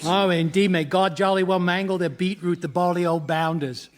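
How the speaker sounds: hammed-up fake English accent; put-on English accent. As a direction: bluster like a stock English gent, fake accent. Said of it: "it has this unique accent".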